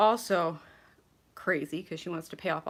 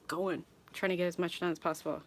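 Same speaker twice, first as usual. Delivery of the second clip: ordinary talk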